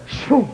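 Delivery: high rushing whoosh; chariot whoosh. Retold or imitated. Preacher imitating the chariot taking off, whooshing. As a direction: imitate something shooting past. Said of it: "I heard the chariot go".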